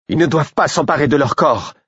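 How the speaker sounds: high, urgent cry; sudden high alarmed exclamation. A narrator's reading. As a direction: cry out that the bodies must be saved. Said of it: "s'exclama-t-elle soudain".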